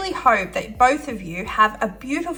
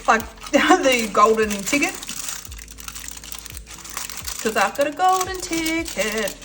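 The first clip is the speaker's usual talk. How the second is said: higher, thrilled movie quote; excited golden ticket kid; 5 semitones higher than usual. Retold or imitated. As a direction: squeal it like finding the ticket. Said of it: "I think of Willy Wonka".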